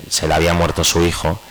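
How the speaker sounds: usual speaking voice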